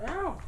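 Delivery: sudden high yelp of pain; sharp pained yelp. Real, not acted. Bitten by a playful puppy, a real yelp of pain. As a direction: cry out as the teeth catch you. Puppy bites during filming.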